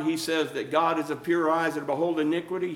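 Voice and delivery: ordinary talk, nothing marked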